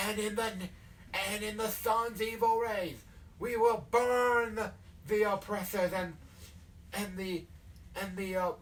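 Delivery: southern sermon swelling on the threat; southern hellfire preacher. Acted. Sword-gripping leader vowing to burn oppressors, southern hellfire preaching. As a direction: preach burning, stretching the key word. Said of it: "like, the hellfire and brimstone thing".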